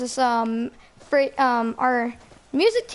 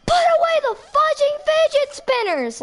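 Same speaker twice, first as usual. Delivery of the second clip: high, far-off shrieking teacher yelling at the class; mock teacher scream; 12 semitones higher, 3 dB louder than usual. Imitating the angry teacher yelling at students over fidget spinners, shrill mock scream. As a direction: screech it like a furious teacher. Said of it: "she would be screaming the F word".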